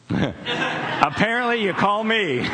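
high, drawn-out laughing delivery; comic disbelief, pitch well up; amused punchline. Real, not acted. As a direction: stretch it out through a laugh. Joking answer to own rhetorical question.

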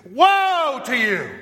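loud, slow, high condemning cry; fiery rebuke. Acted. Jesus denouncing the Pharisees, loud and fiery. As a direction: thunder it at them. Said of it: "And that's how he said it".

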